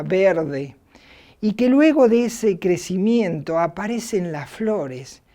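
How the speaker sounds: ordinary talk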